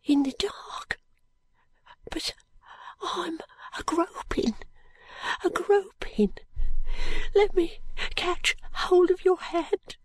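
put-on high, breathless, dying boy whisper; fading boy, barely voiced. A narrator's reading.

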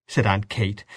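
usual speaking voice

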